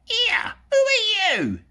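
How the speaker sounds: very high, loud squeak; shrill little enchanted purse crying out. Acted. Magic purse squeaks at the thief, very high. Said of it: "it squeaked".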